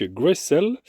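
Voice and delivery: brand name said the English way, Gray-cell; English-accented Graycell, lightly. Real, not acted. Presenting the sender brand, with a light English accent. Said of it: "avec mon accent anglais".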